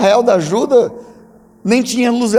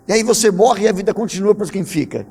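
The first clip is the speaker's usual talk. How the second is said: ordinary delivery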